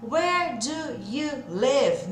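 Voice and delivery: very slow, stretched, word by word; WHERE, DO, YOU, LIVE each dragged out alone; separado, devagarzinho. Shown as a demonstration. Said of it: "o inglês da vida real não é falado assim". (bem devagar, palavra por palavra)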